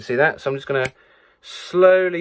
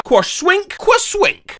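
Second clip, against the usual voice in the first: high squeaky noise; slower than usual. Shown as a demonstration. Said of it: "determine exactly what noise it is".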